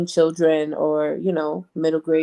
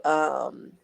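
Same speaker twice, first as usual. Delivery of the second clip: ordinary talk, nothing marked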